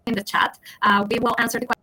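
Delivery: normal talking voice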